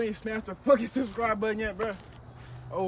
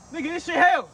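pained loud complaint; 6 semitones higher, 5 dB louder than usual. Real, not acted. Mouth burning from chip.

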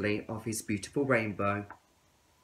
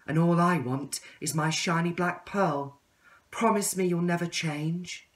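higher, earnest request; caterpillar voice; 6 semitones higher, 3 dB louder than usual. Acted. Caterpillar asking the tadpole to promise, higher. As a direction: ask him for the promise again. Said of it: "said the caterpillar".

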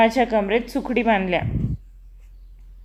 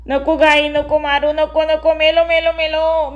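crying out; 4 semitones higher than usual. A narrator's reading. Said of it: "मी ओरडू लागलो".

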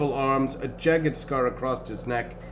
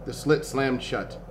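ordinary delivery

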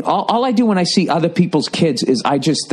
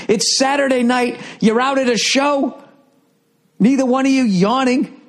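high, far-off incredulous rant; 10 semitones higher than usual. Acted. Comic mocking the fit young parents, in an incredulous high rant. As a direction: marvel at how fit and rested they look.

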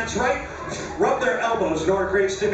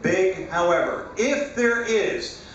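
calm hypnotist suggestion voice; steady, lulling post-hypnotic instructions; hypnotized dreamy voice; 3 semitones lower than usual. Acted.

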